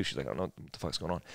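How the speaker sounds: mock hushed shock at a revival; hushed disbelief. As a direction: say it under your breath in disbelief. Aunt's stunned reply in tent.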